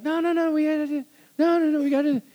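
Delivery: high-pitched imitation of a protesting wife. Wife stopping her hurried husband, high insistent protest. Wife objecting to being rushed.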